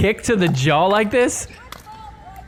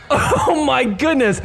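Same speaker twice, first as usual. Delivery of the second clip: laughing; 10 semitones higher than usual. Real, not acted. Still laughing at the kick.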